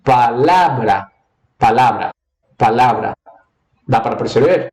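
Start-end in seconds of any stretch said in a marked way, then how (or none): 0.0-3.1 "palavra" with the middle syllable "la" leaned on, first take slow; stress on LA. Shown as a demonstration. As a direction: repeat "palavra" and push the "la". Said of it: "você pode perceber que ela é palavra". (ênfase na sílaba "la" de "palavra")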